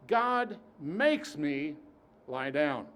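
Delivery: deliberate slow preaching; slow emphasis. Acted. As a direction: draw it out for weight. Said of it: "preacher's emphasis".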